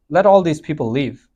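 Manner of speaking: ordinary talk, nothing marked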